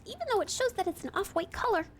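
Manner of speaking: normal talking voice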